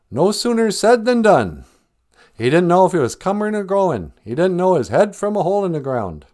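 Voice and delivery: lightly hammed-up grandparent talk; imitating grandparents. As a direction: recite elders' sayings like an old-timer. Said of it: "my grandparents always had funny ways".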